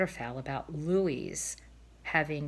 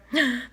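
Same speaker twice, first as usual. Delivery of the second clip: sharp gasp as the shot hits; a quick pained gasp; 7 semitones higher, 4 dB louder than usual. Acted. Cob is shot.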